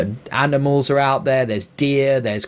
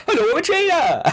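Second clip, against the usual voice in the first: much higher odd merchant voice; game trader voice. Retold or imitated. Mocking the game's trader voice for a friend, much higher put-on voice. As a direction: squeak it like a strange NPC trader. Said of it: "an imitation of the actual voice".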